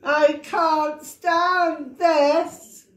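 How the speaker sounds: high, drawn-out and very slow complaint; exasperated Mister Bear. Acted. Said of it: "said Mister Bear".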